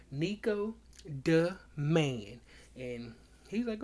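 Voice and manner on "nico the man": "Nico the Man" word by word, with a break after "Nico"; deliberate emphasis. Retold or imitated. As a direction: set apart each word of "Nico the Man". Spelling out the words he demands.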